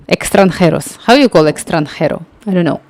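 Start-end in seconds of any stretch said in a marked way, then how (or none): none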